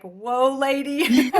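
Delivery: loud, high startled exclamation; startled whoa. Retold or imitated. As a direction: back off, overwhelmed and loud. Overwhelmed by her energy.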